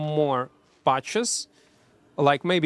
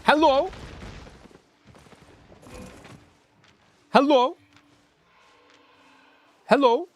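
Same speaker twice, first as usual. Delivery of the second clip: calling out hello; 8 semitones higher than usual. Real, not acted. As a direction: call out to check if anyone's there. Calling out to someone.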